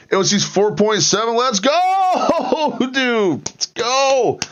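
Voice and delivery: very high-pitched celebratory whoop; excited cheer. Real, not acted. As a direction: cheer a friend on, stretching out "go". Cheering another person's figure.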